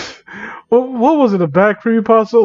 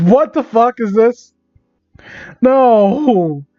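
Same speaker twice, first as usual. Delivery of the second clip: higher-pitched, appalled outburst; exasperated disbelief; 4 semitones higher, much slower than usual. Real, not acted. Reader balks at the botched writing, exasperated. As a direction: break off reading, appalled.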